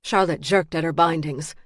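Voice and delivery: plain reading voice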